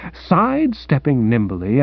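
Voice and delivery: ordinary delivery, nothing marked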